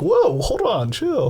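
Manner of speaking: high startled whoa, hold on; mock alarm. Reacting to the guy in the meme with high mock alarm. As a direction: pull back in mock shock. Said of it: "I'm like".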